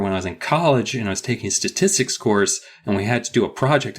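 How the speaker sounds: ordinary delivery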